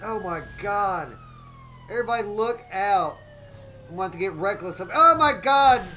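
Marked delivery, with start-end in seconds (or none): 4.8-5.8 loud, drawn-out cry of alarm; startled yell. Real, not acted. Streamer yelling at the chase going wrong. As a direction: yell it as the car spins out.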